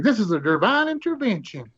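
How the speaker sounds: high, awed exclamation; little lady. Retold or imitated. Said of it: "the little lady's like".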